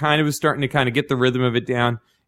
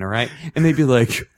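ordinary talk, nothing marked

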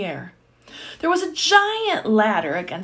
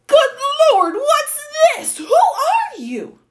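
high, loud astonished outburst; gasping in shock; 7 dB louder, slower than usual. A narrator's reading. Duke facing a talking boy in a beak, astonished shout. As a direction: exclaim in stunned disbelief, high and loud. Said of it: "he gasped".

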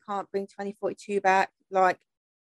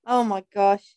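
ordinary delivery

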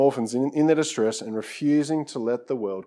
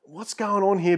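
frustrated; 9 semitones higher, 7 dB louder, slower than usual. Retold or imitated. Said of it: "Josh is getting so frustrated".

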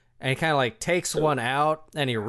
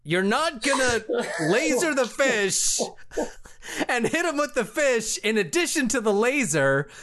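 exasperated; 9 semitones higher than usual. Real, not acted. GM denies laser fish.

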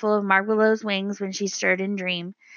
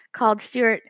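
usual speaking voice

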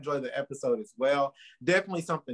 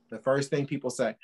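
usual speaking voice

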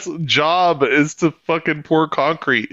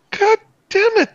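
high mock curse. Retells cursing.

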